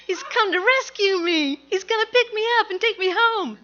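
high, loud, thrilled rush; excited. Retold or imitated. Boy in the road, thrilled to see his dad's car. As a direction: gush with relief that rescue came. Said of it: "I'm really excited".

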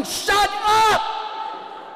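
high, loud yell; yelling shut up. Retold or imitated. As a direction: snap at the chanting kid. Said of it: "And I'm, like, yelling".

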